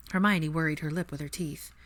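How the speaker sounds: plain reading voice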